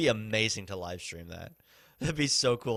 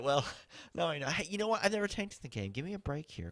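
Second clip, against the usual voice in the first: usual speaking voice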